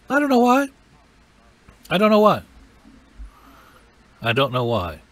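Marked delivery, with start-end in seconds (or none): 0.1-0.7 much higher, louder puzzled voice; high-pitched bewilderment. Acted. Preacher wondering at long hours of prayer, high bewildered voice. 1.9-2.4 high, louder puzzled outburst; high-pitched bewilderment. Acted. Preacher repeating his puzzlement over prayer, high and loud. High take before usual-voice take.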